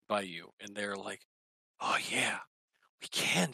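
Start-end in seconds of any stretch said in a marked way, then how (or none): 1.8-3.5 breathy hushed awe; awed whisper. Acted. Enraptured orc whispering to the player, hushed awe. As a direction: whisper it, spellbound.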